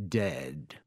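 low, soft, final; quiet gloating. A narrator's reading. Villain pronouncing the boy dead, low gloating. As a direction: drop it low with relish.

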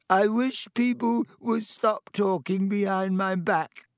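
high, slow tearful wail; choked with tears. A narrator's reading. Myrtle thinks she is gossiped about, tearful. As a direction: wail it through tears, drawn out. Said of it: "in a voice choked with tears".

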